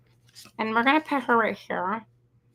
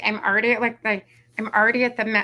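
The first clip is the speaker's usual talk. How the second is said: ordinary talk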